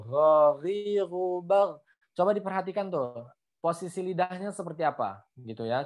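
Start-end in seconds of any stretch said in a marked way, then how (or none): 0.1-1.8 Ghariru bau slow, with snore-like gh; ghain berdengkur. Shown as a demonstration. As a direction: stretch Ghariru bau, rasping each gh. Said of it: "pokoknya kayak ada dengkurnya gitu". (Ghariru bau pelan, gh seperti mendengkur)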